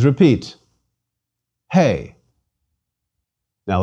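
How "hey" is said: "Hey" with an audible H at the start; rough breathing. Shown as a demonstration. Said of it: "should be pronounced with an H".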